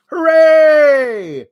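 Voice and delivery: high, loud cheering crowd; mock crowd cheer. The crowd cheers the triple threat match, high and loud. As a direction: squeal hooray like a thrilled fan.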